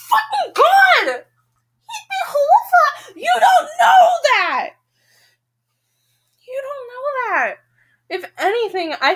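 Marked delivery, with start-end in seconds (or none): 0.1-4.7 gasp, then a high, slow, laughing shout; frustrated outburst. Real, not acted. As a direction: burst out in real frustration, half laughing. Reader cries out at the game.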